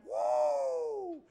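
big high whoop of delight, shouted. Retold or imitated. Imitating Madison cheering at the funeral, whooping shout. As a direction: let out a huge joyful shout. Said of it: "that was like".

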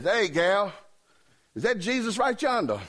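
put-on high-pitched hillbilly accent; funny accent. Hillbilly hailing the waitress about Jesus, mock country accent. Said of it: "he had a funny accent".

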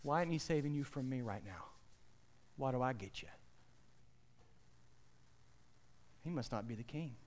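low, soft, sly taunt as Death; a hint of a gloating Death, hushed. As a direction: Death quietly gloating over a dying believer. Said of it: "Death says".

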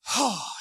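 huge acted exhale; long, relieved sigh; sigh of relief. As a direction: let out a big breath of relief. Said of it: "He breathes a huge sigh of relief".

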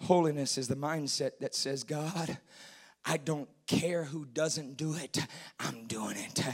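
low, very soft resolve; hushed preaching. Real, not acted. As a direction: preach it nearly in a whisper.

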